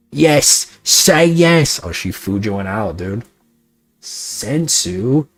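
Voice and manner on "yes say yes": loud, high urging yell; excited cheering. Real, not acted. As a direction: egg him on to say yes. Cheering friend urging a yes.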